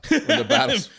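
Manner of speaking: high loud laughing burst; excited. Real, not acted. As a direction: blurt it out laughing. Excited laughing about battles.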